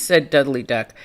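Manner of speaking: ordinary delivery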